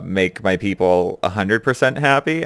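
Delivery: normal talking voice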